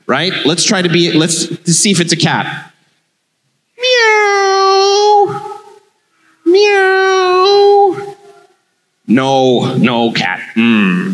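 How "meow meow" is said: high stretched meow, much higher; a long, high, cartoonish cat meow; mock meow. Martian tries cat noise on phone.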